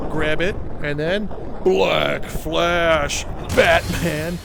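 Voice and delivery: slow, loud move call; hyped announcement. Real, not acted. Gamer landing his big combo, slow loud hype call. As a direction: call out the finisher like an anime attack.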